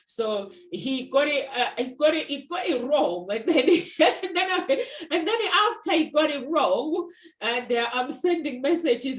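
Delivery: loud, high laughing retelling; laughing while telling. Real, not acted. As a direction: laugh your way through the story. Laughing while retelling brother's mix-up.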